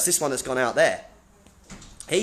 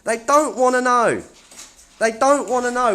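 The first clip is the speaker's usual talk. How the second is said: calling out; 6 semitones higher, 4 dB louder than usual. Real, not acted. Calling out at staff who leave.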